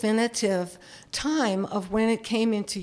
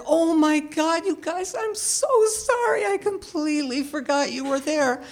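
embarrassed apology; 8 semitones higher than usual. Retold or imitated. Grandma apologizing to the shocked girls, high flustered voice. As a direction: gush sorry to the kids in back. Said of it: "And I said".

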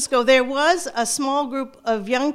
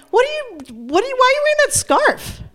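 much higher, nosy questioning; curious teachers; 11 semitones higher than usual. Retold or imitated. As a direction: teachers pounce on the scarf. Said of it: "start saying".

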